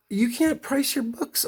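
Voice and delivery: slow, high incredulous question; retold disbelief. Customer challenging the shop owner over pricing, incredulous.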